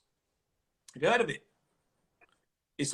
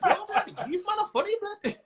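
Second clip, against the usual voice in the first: put-on high, excited Mancunian accent; Manchester accent; 11 semitones higher than usual. Manchester crowd hailing the comedian, excited local accent. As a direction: put on a keen Manchester fan, pitched way up. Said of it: "I hear the accent".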